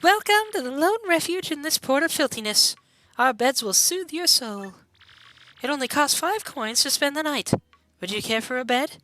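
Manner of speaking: high, lilting innkeeper voice; welcoming innkeeper. Acted. Innkeeper offers a bed for five coins, high lilting welcome. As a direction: pitch the room in a high, sweet put-on voice.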